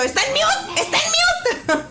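high, half-laughing yell. Retold or imitated. Mom yelling at son the mic is on mute, half laughing. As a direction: shout the warning, cracking up. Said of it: "todavía le grito".

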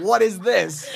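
puzzled question with laughter; amused confusion; mock baffled. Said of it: "people will be like".

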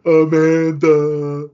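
long, loud called name; drawn-out call. Acted. Friend calling out a movie character's name, long and loud. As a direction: stretch the name out like calling across a room.

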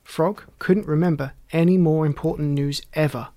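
ordinary delivery, nothing marked

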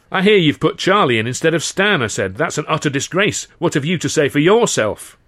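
loud, outraged retort; indignant. A narrator's reading. To the governor, loud and indignant at the disgrace. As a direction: throw his words back at him, loud.

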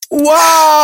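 ordinary delivery

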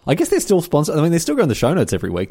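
bright salesy pitch; ad read. Acted. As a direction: pitch the sponsor enthusiastically. Sponsor read.

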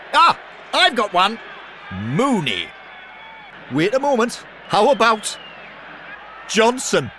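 high, loud eager shouts; excited shouting. Acted. Ghost shouting Watford player names in a game, high and eager. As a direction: blurt out each player name.

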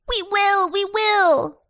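high, loud, eager cry; calling in chorus. A narrator's reading. Vegetables promise to make it up, calling out high. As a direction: pipe up loudly like a cheering crowd. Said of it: "called the vegetables in chorus".

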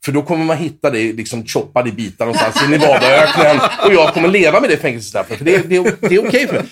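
a joking threat that keeps cracking into laughter; laughing mock threat. To friend Andreas about Paul's album, laughing mock threat.

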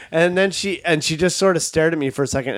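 ordinary delivery

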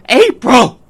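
calling a lost daughter across a loud pub; desperate shout. Acted. Running through hospital corridor.

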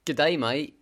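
loud put-on Australian greeting; mock Australian, said with gusto; Australian accent. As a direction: give it a broad Australian accent. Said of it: "You do an Australian accent".

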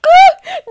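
loud high squeal of a laugh; helpless high laugh; high shriek of laughter. Real, not acted. Bursts out laughing.

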